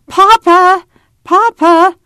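put-on high, loud child's shout for father; calling out. A narrator's reading. Ada calls for distant Papa, high full shout. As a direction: holler far into the distance. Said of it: "lifted her head back and called".

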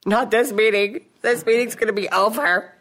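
high, laughing dramatic protest; laughing mock protest. Telling her daughter about the last meeting, high and laughing. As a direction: insist through laughter, half joking. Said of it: "I said".

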